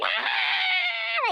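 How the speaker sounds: sudden high panicked shriek; short, high, wailing scream; imitated scream. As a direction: mimic a detained mother's cry of alarm. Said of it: "start screaming and going".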